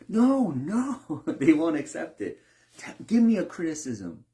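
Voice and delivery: high and loud, waving off the praise; insistent refusal. Retold or imitated. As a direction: refuse the compliment, demand a critique.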